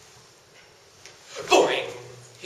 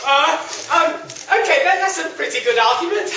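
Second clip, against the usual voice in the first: panicky; 7 semitones higher, 5 dB louder than usual. Acted. Hero panics before monster.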